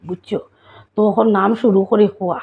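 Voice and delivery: ordinary talk, nothing marked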